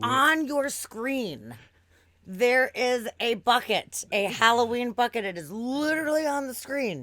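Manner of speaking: slow, loud, over-enunciated pointing out; exasperated explaining. Retold or imitated. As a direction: spell it out slowly as if to a child. Exasperated with the drive-thru worker.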